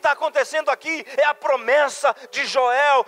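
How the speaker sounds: high, loud rapid-fire preaching cadence; pregação inflamada. Real, not acted. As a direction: preach at full force, driving phrase after phrase. Preaches at full force. (pregação fervorosa, voz alta e aguda)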